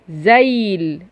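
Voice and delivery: زيل drawn out to its final L; sounded-out L. Shown as a demonstration. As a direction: hold the end of زيل for the learners. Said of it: "أدي اللام". (مد زيل لإظهار اللام الأخيرة)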